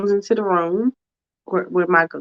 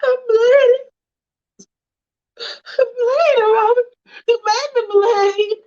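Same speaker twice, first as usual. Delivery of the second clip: much higher, wailing and crying; sobbing wife. Acted. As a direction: cry out through the tears, pleading. Wife sobbing after being hit.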